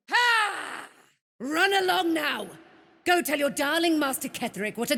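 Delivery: high, sneering jeer; mocking taunt. Villain dismissing Sharon's servant, high mocking taunt. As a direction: jeer her away.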